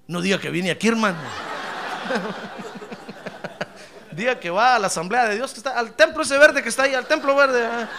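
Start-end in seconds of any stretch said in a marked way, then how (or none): 1.2-4.1 soft voiceless chuckle; breathy laugh. Real, not acted. Preacher laughing at his own joke, breathy. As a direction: let out an airless laugh.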